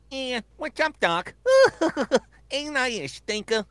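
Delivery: high cartoon rabbit with a mouthful of carrot; Bugs Bunny. Retelling the voice actor eating carrots on mic, cartoon impression. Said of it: "he'd be like".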